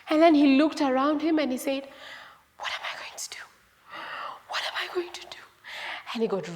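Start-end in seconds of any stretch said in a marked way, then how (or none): 2.6-5.4 frightened, breath-only whisper; hushed storybook panic; scared whisper. Acted. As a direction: whisper it in worry, twice over. Scared son wonders what to do.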